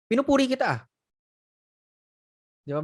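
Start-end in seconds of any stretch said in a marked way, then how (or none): none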